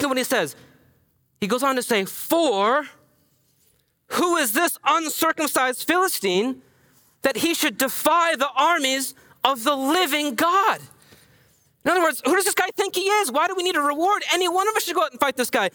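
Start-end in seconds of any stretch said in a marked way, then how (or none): 2.0-10.8 slow, higher, outraged rhetorical challenge; indignant question. Retold or imitated. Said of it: "He's asking the question in such a way that". 11.8-15.7 indignant. Retold or imitated. Paraphrasing David's outrage.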